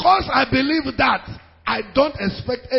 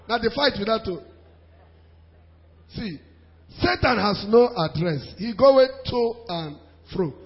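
ordinary talk

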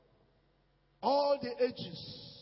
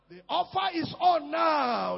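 preaching shout; 4 semitones higher, 6 dB louder, slower than usual. Acted.

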